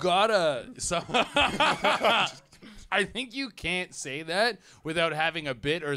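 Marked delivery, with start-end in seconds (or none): 1.1-2.3 high, loud burst of laughing; helpless amused laugh; real laughter. Real, not acted. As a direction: crack up at the interjection. Host laughs at interjection.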